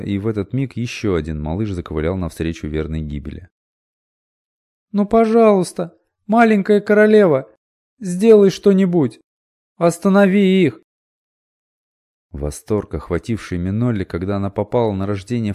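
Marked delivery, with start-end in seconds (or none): 4.9-10.8 high, loud tearful-sounding plea; child begging. A narrator's reading. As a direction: implore her at full voice. Girl pleads with little queen.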